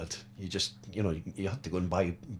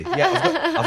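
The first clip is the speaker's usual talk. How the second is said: laughing; 10 dB louder, much slower than usual. Real, not acted. Laughing joke reply.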